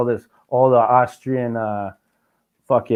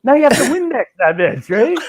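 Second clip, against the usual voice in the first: high and indignant, a bit louder; mock-outraged high rant about smudged glass; indignant squeal; 10 semitones higher, 4 dB louder than usual. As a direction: keep squealing with offended disbelief. Retold outrage at glass door.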